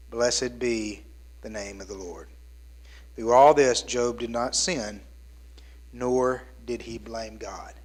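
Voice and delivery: low, hushed Bible reading; solemn scripture reading voice. Acted. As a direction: read the passage gravely and softly. Scripture reading before sermon.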